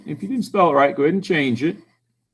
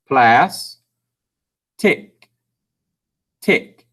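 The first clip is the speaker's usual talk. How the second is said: each syllable isolated and crisp; sounding it out; much slower than usual. Shown as a demonstration. Teacher hunts for vowels, sounding out syllable by syllable. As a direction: separate syllables with long gaps. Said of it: "We're sounding it out".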